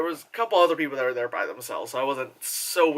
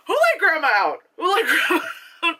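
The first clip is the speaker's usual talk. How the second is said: high, laughing words; cracking up; 12 semitones higher, 3 dB louder than usual. Real, not acted. Joking about the old lady, cracking up. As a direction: call it out through real giggles.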